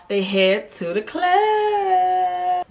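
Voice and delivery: high, slow sing-song announcement; excited. Real, not acted. Host announcing the club night, sing-song excitement. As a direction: stretch it out like a party hype.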